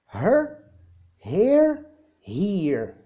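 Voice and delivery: her with a short er, then here with a long ee; her against here. Shown as a demonstration. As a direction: contrast her and here clearly. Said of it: "الكلمة التالتة اللي هي هنا". (her و here بفرق واضح بين الصوتين)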